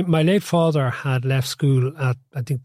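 normal talking voice

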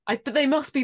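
ordinary talk

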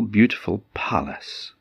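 plain reading voice

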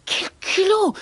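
high, indignant serpent hiss; hissing snakes. Acted. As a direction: hiss in outrage, high-pitched. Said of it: "sifflent les serpents".

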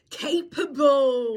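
mock pep talk; drawn-out capable. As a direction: drag it out like a mock motivational speech.

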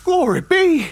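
high startled cry; startled exclamation. Acted. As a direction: gasp it out, spooked. Startled by ghost talk.

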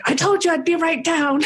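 high-pitched mock screech; comically overwrought, breaking into a laugh; exasperated retort. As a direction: re-enact losing patience with a caller. Retold impatient reply to repeated calls.

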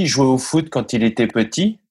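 ordinary delivery

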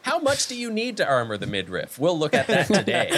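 high, laughing host voice; podcast host. Pretend. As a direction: present it like a show host. Mock podcast.

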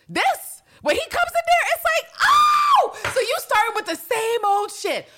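high, loud, mocking shriek; shrieking excitement. Host mocking Constantine's arrival, shrieking. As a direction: squeal it out in mock glee.

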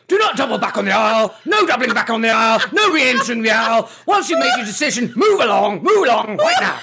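loud, fast barked orders; Sergeant Major. Pretend. Woman doing sergeant major orders for supermarket shoppers. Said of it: "Sergeant Major voice".

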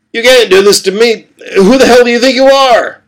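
high, loud outraged yelling; furious shout. Acted. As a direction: scream it at the captors who won't let you go. Man fights hospital tube, furious.

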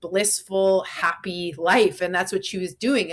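normal talking voice

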